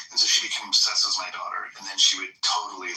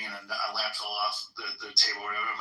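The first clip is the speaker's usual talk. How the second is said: ordinary delivery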